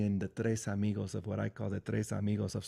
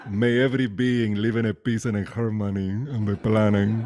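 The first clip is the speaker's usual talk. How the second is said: louder put-on voice, part vampire, part [name]; Dracula-[name] mix voice; 8 dB louder than usual. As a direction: ham it up like a campy Dracula crossed with [name]. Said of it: "like, Dracula and [name]".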